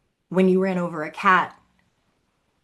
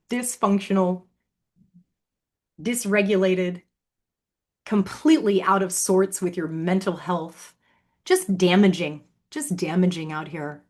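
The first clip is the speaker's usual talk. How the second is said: usual speaking voice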